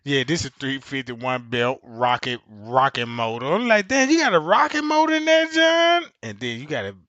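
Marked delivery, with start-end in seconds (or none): 3.9-6.1 much higher, loud amazed question; amazed shout. Retold or imitated. Reacting to the rocket motor, high loud amazement. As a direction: call it out in disbelief.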